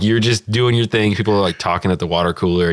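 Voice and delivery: ordinary talk, nothing marked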